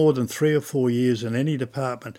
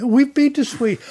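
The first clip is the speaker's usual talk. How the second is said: much higher and louder, gushing; excited chatter; 5 dB louder than usual. Retold or imitated. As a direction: blurt it out thrilled to share. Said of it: "you talk like this".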